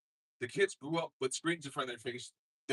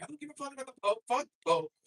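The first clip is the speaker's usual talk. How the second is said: fast, much higher dismissive rant; mock Trump; 12 semitones higher, faster than usual. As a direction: rattle it off like a careless Trump. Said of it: "Trump's like".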